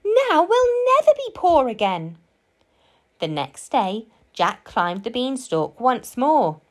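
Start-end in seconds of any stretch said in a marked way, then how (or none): 0.0-2.2 high-pitched, overjoyed mother; delighted cry. A narrator's reading. Mother celebrating with Jack, high and overjoyed. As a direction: rejoice up high. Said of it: "cried Jack's mother".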